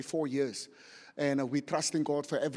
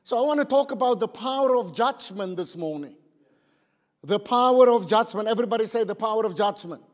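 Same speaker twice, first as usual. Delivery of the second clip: loud, raised proclamation; full-force preaching; 5 semitones higher, 9 dB louder than usual. Real, not acted. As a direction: ring out the theme to the congregation. Preacher announces theme at full force.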